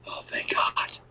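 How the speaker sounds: whispered, breathy relief; hushed relief of the wrestlers; relieved whisper. Retold or imitated. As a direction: sigh it out under your breath. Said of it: "all the guys were like".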